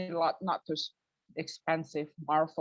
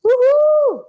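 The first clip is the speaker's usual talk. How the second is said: very high, loud whoop; excited woohoo; 19 dB louder than usual. Real, not acted. Comic whooping at the crowd as she arrives, high loud cheer. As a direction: whoop like you own the room.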